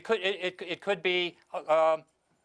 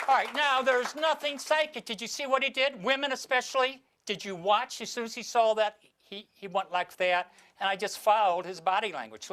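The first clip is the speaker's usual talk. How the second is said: high, animated explaining; excited; 6 semitones higher than usual. Real, not acted. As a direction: let the energy spill over. Excited reveal explaining trick.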